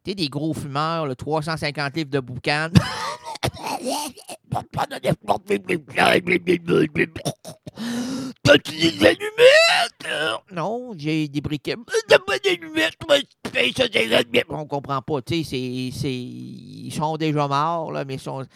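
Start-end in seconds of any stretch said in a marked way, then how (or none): 2.7-8.4 wheezy smoker laugh. Acted. Acts out a heavy smoker. 8.4-10.4 high, slow, loud wheezing smoker; old chain smoker begging for matches, shouting; raspy smoker shout. Acted. Heavy smoker asking for matches. 12.1-14.5 smoker shout. Acted. Smoker keeps begging.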